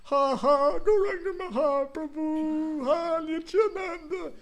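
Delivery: high, slow wailing cry. Retold or imitated. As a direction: wail the holy names in tears. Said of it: "and you start crying".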